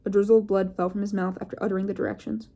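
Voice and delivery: plain reading voice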